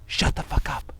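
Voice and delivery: hissed whisper telling him off; angry whisper. Acted. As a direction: whisper it sharply. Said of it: "have an excessively quiet".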